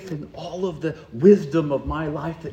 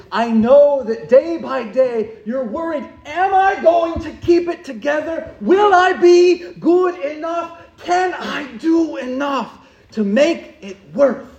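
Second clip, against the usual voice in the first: rising, louder, almost shouting questions; anxious pleading; 9 semitones higher, 9 dB louder than usual. Acted. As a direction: cry out the worries as they build. Voicing Martha's anxious questions.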